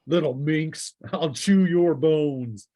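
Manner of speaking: a lightly voiced gloating brute, slow on the last words; menacing monster taunt. Game master voicing a bugbear threatening Mia, mildly menacing. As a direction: growl a mild threat at the hero.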